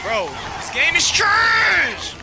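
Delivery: high, loud raging yell, slowing on the last line; angry shout. Real, not acted. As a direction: rage at the game. Raging at the game.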